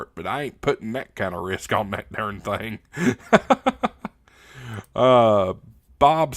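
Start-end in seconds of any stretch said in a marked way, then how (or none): 2.8-4.1 laugh. Real, not acted. Laughs at his own risk remark.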